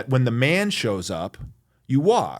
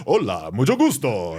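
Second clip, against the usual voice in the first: hammed-up gringo Spanish, mocking dad; imitating a TV host greeting in overdone Spanish; mock Spanish-accent greeting, a bit louder; caricature Spanish accent; 2 semitones higher, 4 dB louder than usual. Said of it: "sounds like a caricature of someone trying to speak Spanish".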